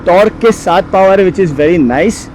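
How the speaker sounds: loud, high voice over roaring wind; shouting over wind. Real, not acted. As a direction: raise your voice over helmet wind. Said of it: "sorry about the wind noise".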